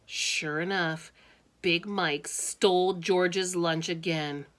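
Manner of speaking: ordinary delivery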